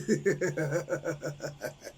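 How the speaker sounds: a real chuckle after the joke; amused laugh at his own teasing. Real, not acted. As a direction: laugh it off at the mockery.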